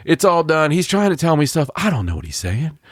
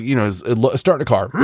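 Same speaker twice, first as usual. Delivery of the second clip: ordinary delivery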